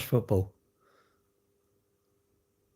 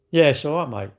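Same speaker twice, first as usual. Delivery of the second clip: ordinary delivery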